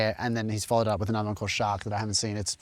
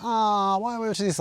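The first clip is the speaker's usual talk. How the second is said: higher, whiny imitation of a student; imitating a bored student, lightly; mock bored student; 9 semitones higher than usual.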